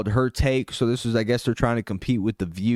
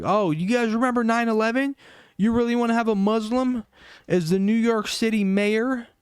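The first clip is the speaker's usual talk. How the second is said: a much higher, sneering parody voice; mocking tweet; 10 semitones higher than usual. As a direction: ham up the fearmongering pundits. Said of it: "tweeting out like".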